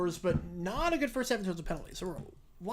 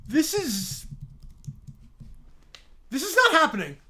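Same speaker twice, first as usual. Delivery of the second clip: loud, high, can't believe it; disbelieving shout; 4 semitones higher, 4 dB louder than usual. Real, not acted. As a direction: yell in disbelief. Reacting to gift.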